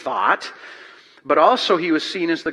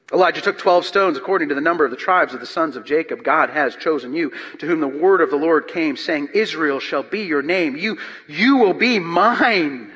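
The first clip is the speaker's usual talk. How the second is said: loud, high, driving pulpit reading; preaching at full force; 3 semitones higher, 4 dB louder than usual. Acted. Preacher to the congregation, reading Elijah's challenge, booming. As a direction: proclaim it to the back row.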